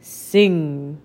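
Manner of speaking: low, slow giant command; low giant order; dim giant cyclops. Acted. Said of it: "Then the giant said".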